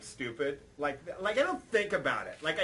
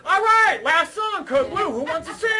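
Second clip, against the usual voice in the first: high, loud call to the crowd; rally the whole club from the stage; stage announcer shout; 11 semitones higher, 11 dB louder than usual. Retold or imitated. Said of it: "and I go".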